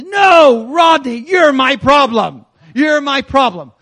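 angry officer shouting, loud and high; shout across room. Retold or imitated. Angry officer yells at the preacher across the room, shouting. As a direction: bellow it across a crowded room. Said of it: "he shouts across the room at me".

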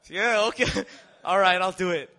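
hammed-up reluctant yes; flustered agreement. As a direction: give in noisily, half laughing.

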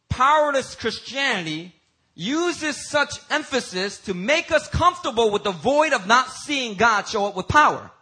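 high-pitched preaching at full force; impassioned preaching. Real, not acted. Preacher decrying powerless Christianity to his congregation, impassioned preaching.

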